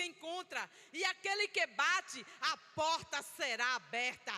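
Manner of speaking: loud, rising pulpit proclamation; full-force preaching. Acted. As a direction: preach it, building to a prophecy.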